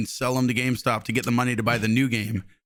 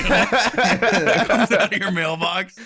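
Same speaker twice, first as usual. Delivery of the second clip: laughing; 7 semitones higher, 3 dB louder than usual. Pretend. Mock IRS agent, laughing.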